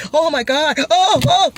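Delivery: high, loud mock panic on the drop; mock ride scream. Acting out a roller coaster rider's panic, high and loud. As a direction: squeal like you're plunging down the track. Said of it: "So you've got the".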